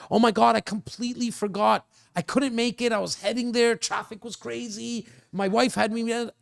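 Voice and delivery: high-pitched imitation of an apologetic no-show; excuse-making client. Acting out no-show's excuses.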